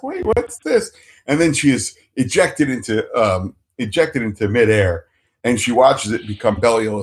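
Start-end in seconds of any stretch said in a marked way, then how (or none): 0.0-0.9 high puzzled voice; sudden high confusion; surprised. Retold or imitated. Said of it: "she's like".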